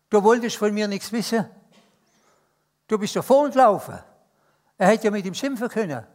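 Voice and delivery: impassioned preacher; preaching at full force. Acted. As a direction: drive the reproach home from the pulpit.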